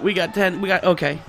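ordinary delivery

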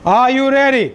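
rousing call from the belly; hype call. Shown as a demonstration. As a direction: project it to the whole hall. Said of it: "हम फिर से try करेंगे".

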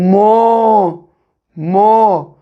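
Mo said as one syllable, M opening into a round O; blended syllable mo. Shown as a demonstration. Teacher sounding out the syllable for pupils. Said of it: "Mo diye okuruz değil mi".

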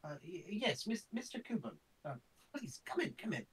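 put-on high quavering old man voice; a second try at the scholar's voice. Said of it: "I can't find the voice".

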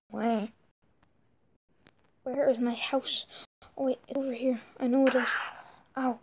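normal talking voice

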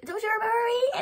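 high, eager little character voice; high-pitched pleading question, imitated; imitated Kobe. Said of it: "then he's like".

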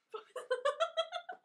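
squealing burst of laughter; shrieking giggle; loud high laugh. Real, not acted.

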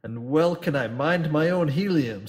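captain answering Nancy heatedly. Acted. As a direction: fire it back with heat. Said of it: "said the captain warmly".

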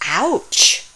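ordinary delivery

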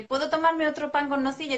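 put-on child pleading for another snack; wheedling child. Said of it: "con lo de".

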